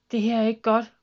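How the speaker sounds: urgent bird call; worried shout. A narrator's reading. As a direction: call out that trouble is coming. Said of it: "der råbte".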